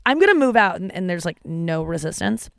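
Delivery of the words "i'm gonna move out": higher, louder petulant declaration; mock threat. Son threatening his parents he'll move out, mock loud. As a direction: announce it like a sulky threat.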